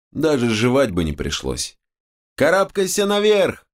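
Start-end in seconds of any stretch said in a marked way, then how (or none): 2.4-3.7 high, urgent yelled order; shouted command. Acted. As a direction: shout it up at her. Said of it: "выкрикнул я".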